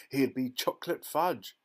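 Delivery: restrained high shriek. A narrator's reading. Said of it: "shrieked Missus Gloop".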